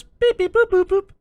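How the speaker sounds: high, loud robot beeps. Retold or imitated. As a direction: chirp it like a droid. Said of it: "and he says".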